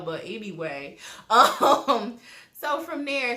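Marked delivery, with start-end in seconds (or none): 1.1-1.3 amused laugh; short real laugh. Real, not acted. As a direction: burst out laughing at the irony. Laughs at [name].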